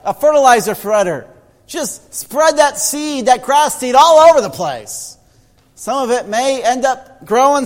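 high, loud, slowed preacher's cry; preaching at full force. Acted. Preacher urging the church to spread the seed, at full force.

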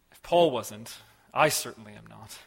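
ordinary talk, nothing marked

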